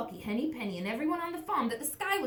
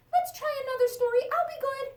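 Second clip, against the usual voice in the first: high, eager little chick voice; little chicken voice; 6 dB louder, faster than usual. Acted. Chick asking her papa for a bedtime story, high and eager. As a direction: squeak it up like a small excited chick.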